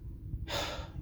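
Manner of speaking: soft, breathy sigh of exhaustion; weary sigh. Real, not acted. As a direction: let out a long, tired breath. Exhausted, recounting being turned away.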